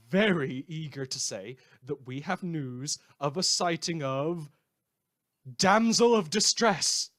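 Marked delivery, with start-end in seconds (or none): 5.6-7.1 loud and slow, each word announced like a headline; dramatic announcement. Acted. Radio host announcing a villain sighting with loud drama. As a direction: boom out the name like breaking news.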